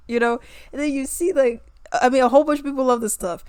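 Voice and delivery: normal talking voice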